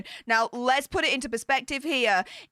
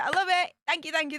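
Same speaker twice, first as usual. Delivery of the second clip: usual speaking voice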